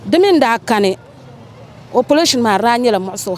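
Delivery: ordinary delivery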